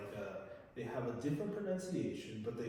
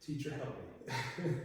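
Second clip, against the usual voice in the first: slow, put-on voice of a pleading student; student asking her teacher for help, slow mock plea; slower than usual. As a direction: mimic the student begging for help, slowly. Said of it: "and she says".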